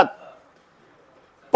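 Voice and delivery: प breathed out without voice, lips pressed; just the puff of प, no voice; unvoiced प to show the lips; voiceless lip p. Shown as a demonstration. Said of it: "बोलो ओठ में टकराई".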